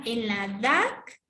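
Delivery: dac stretched and rising, with a hard final k; stress on DAC. Shown as a demonstration. Teacher pointing out the strongest syllable, long rising stress on dac. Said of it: "está la mayor fuerza de voz".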